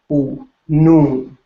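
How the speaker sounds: Oo, noon with a long loud oo; oo stretched out, then noon; stressed long oo. Shown as a demonstration. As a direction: punch the oo in noon. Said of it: "putting a lot of emphasis on the oo sound".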